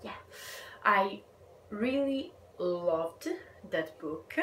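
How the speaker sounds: usual speaking voice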